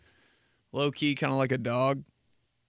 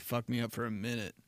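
ordinary talk, nothing marked

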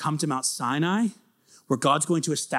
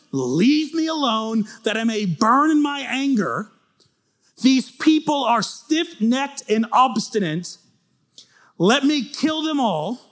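slow burning anger; 7 semitones higher, 3 dB louder, slower than usual. Retold or imitated. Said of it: "his anger starts to burn".

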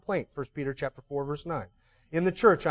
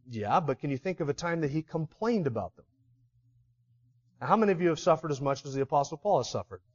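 usual speaking voice

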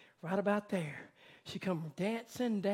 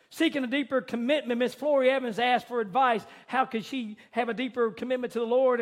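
louder, raised preaching voice telling the story; fervent preaching; 5 semitones higher, 7 dB louder than usual. Acted. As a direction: preach it out to the congregation.

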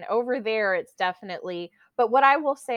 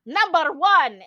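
loud, high game call; mock teacher's big announcement; imitated acting teacher; 9 semitones higher, 9 dB louder, slower than usual. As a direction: call out the count like a game leader. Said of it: "he'd clap his hands and go".

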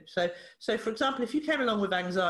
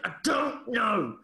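higher, slow, each word forced out; retold angry outburst; 4 semitones higher, much slower than usual. Pushed boy snapping back at the therapist, angry outburst. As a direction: imitate a wound-up boy exploding, stretching every word. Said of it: "he clenched his fist and went".

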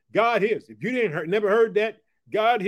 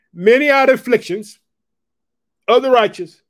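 much louder, higher proclamation; loud scripture proclamation; 6 semitones higher, 12 dB louder than usual. Acted. Preacher booming the psalm to the persecuted church. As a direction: boom the verse out.

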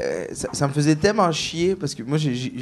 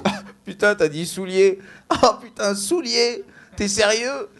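higher-pitched mock France French accent; French comedians imitated; 5 semitones higher than usual. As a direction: mimic French colleagues in amused disbelief, pitched up. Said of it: "les autres humoristes sont comme". (imitation d'humoristes français, plus aiguë)

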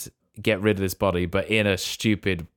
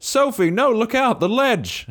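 very high, louder comic shout; mock panicked warning; 13 semitones higher, 4 dB louder than usual. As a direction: yell a hammed-up warning to someone in danger. Acts out warning shout at ledge.

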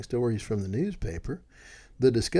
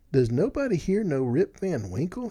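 higher-pitched plea to a crowd; bewildered, raised appeal; crying out; 6 semitones higher, 3 dB louder than usual. A narrator's reading. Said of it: "he cried".

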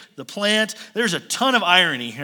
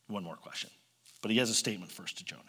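fast, soft unvoiced whisper; 10 semitones lower, 12 dB quieter, faster than usual. Real, not acted. Preacher hushing the room before God's statement, whispering. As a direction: lean in and whisper the setup.

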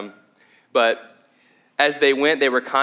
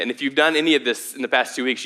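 usual speaking voice

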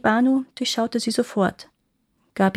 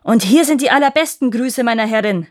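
gnome's shout; 3 semitones higher, 7 dB louder than usual. A narrator's reading. Ghost dwarf to the courtiers, swinging his club, shouting. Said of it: "schwang seine Keule und schrie".

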